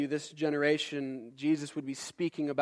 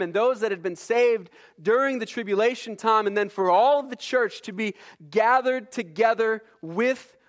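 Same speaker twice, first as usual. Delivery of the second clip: higher, insistent preacher's push; full-force preaching; 5 semitones higher, 5 dB louder than usual. Acted. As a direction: press the point like a pulpit sermon.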